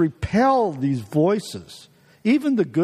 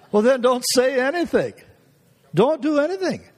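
God's reply; 6 semitones higher than usual. Retold or imitated. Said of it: "God's answer, voiced".

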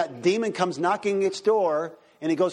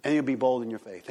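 ordinary talk

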